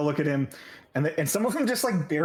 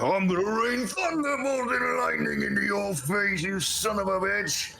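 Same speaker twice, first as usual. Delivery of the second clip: high, slow mock threat; hammed-up thundering threat, high; threatening god; 8 semitones higher, slower than usual. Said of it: "Elias is like".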